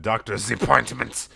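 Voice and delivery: normal talking voice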